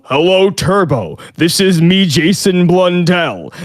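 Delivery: slow, harsh put-on voice; mock harsh Jason Blundell voice. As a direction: growl the name out slowly. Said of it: "this harsh fucking".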